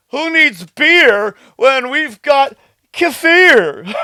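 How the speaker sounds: slow, loud, high hammed-up catchphrase; mocking Carnivore MD. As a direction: draw it out as a cheesy mocking quote. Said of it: "who says, like".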